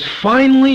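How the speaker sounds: "Finally" punched high and loud; stress on finally. Shown as a demonstration. Preacher stressing "Finally" to the congregation, high and loud. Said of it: "I want to emphasize the word "finally".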